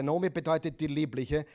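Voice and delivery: ordinary delivery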